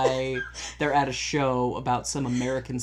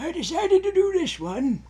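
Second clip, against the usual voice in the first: put-on high, creaky elderly voice; old person voice; 5 dB louder than usual. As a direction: speak like a frail old lady. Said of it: "Should I do an old person voice?".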